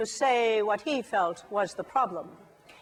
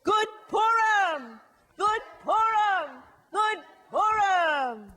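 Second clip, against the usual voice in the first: higher, louder, slow festive shout; slow ringing holiday shout; loud joyful greeting; 7 semitones higher, 5 dB louder, slower than usual. Shown as a demonstration. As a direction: boom it out like a holiday cheer. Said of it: "Let's try it again".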